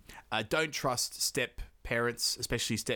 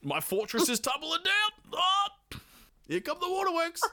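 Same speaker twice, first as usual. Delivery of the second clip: mock crying. Father breaking into tears over his kids, mock wailing. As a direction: wail it like a dad falling apart, overdone.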